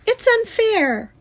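high, loud, drawn-out wail; sobbing protest. A narrator's reading. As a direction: wail it like a child in tears. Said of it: "she sobbed".

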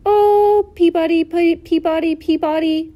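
high, loud sparrow whistle call; bird call. A narrator's reading. Narrator voicing a sparrow calling from the woods, high bird call.

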